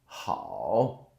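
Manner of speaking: ordinary delivery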